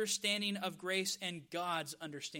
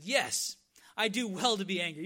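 put-on loud, defiant yes like a cross child; angry little kid; 8 dB louder than usual. Jonah answering God's question like a little kid, loud and angry. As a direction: insist on it loudly, like a sulky kid. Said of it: "He's like a little kid".

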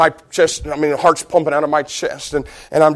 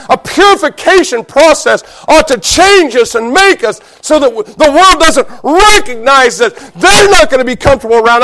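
raised pitch, building in loud rhythmic waves; full-force preaching; 6 semitones higher, 6 dB louder than usual. Real, not acted. Preacher pleading for burning desire, exhorting at full force. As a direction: drive the list upward to a climax.

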